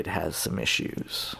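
softly, slowly, almost under the breath; delicate understatement. Real, not acted. Guest delicately admitting a country's problems, hushed and slow. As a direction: tread gently, voice dropped low and slow. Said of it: "To put it delicately".

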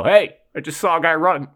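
high, loud mock call-out; imitated window yell. Wanting to alert police out the window, imitated yell. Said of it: "yell out the window".

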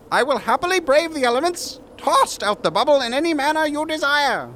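high theatrical speech; grand orator voice. Acted. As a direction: plead to the council in grand style. West addresses the council.

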